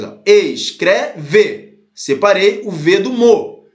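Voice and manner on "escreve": "Escreve" in three distinct beats, es, cre, ve; deliberate syllable count on "Escreve", each one stressed; separated syllables. Shown as a demonstration. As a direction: break "Escreve" evenly into its syllables. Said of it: "A gente vai separando".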